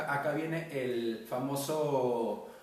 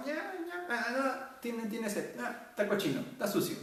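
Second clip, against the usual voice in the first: imitating mother; 9 semitones higher than usual. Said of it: "Tú llorabas".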